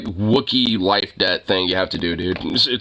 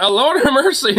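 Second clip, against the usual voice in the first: much higher, louder mock exclamation; imitating flustered lady; 4 dB louder than usual. Retold old lady startled by the dog, high mock exclamation. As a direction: cry out to the Lord in a high put-on voice.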